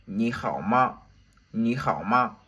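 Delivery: ni hao with the final ma light and toneless; ni hao ma, with ma short and unstressed; neutral tone ma. Shown as a demonstration. Teacher showing the toneless question particle ma. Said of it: "c'est le ma de la question".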